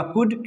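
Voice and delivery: कुड with no L at all; silent L. Shown as a demonstration. Teacher naming the right sound, कुड with silent L. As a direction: say कुड leaving L out. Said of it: "सही sound होगा".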